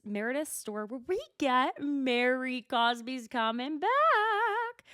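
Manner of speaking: rising squeal of excitement; excited. Real, not acted. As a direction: build up to a thrilled shriek. Hyped announcing Mary's return.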